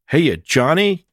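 high, loud call into the building; yelling into the kitchen. Acted. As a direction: shout for Johnny through the back door. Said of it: "Chance yelled from the back door".

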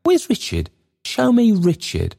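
high, pleading wail; plaintive searching. A narrator's reading. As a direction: call out high for someone missing. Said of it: "looking for his guide and saying".

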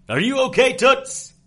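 high, loud call across alley. Acted. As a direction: shout to check on her. Hero calls out mid-fight.

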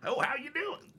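very high put-on hello; mock awkward greeting. Host imitating church members awkward with new people, high greeting. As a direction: squeak out an over-friendly greeting.

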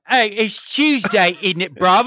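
high, slow, hammed-up London British accent; mock British accent. Co-host mimicking Idris Elba's British accent, hammed up. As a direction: send up a cheeky British bloke. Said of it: "making fun of British people".

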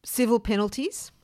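usual speaking voice

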